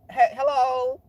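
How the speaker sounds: high, loud mock hello; hammed-up queen greeting. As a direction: trill a campy hello as the queen. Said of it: "he said".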